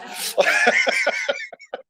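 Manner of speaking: high burst of laughter; big laugh at your own joke. Real, not acted. Panelist jokes to colleagues about wanting Animal House from college, high laughing.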